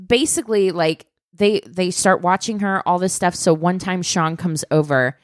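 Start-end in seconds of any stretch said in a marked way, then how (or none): none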